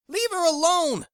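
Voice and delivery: high, loud angry yell. Acted. Jackie kicking Tony off Kathy, angry yell. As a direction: scream at him to back off.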